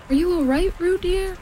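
high, worried motherly call; anxious calling. A narrator's reading. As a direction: call out anxiously to your little one. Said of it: "called Kanga anxiously".

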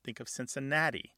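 usual speaking voice

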